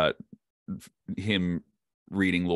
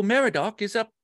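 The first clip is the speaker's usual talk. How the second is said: much higher, placing the voice up top; high voice placement. Retold or imitated. Retelling Serkis on Colbert, higher Meriadoc placement. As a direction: lift the voice way up for Meriadoc. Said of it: "And he goes".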